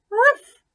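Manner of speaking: high, loud yappy bark; high loud dog bark. A narrator's reading. Dog barking in story.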